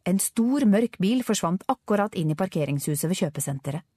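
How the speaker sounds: ordinary delivery, nothing marked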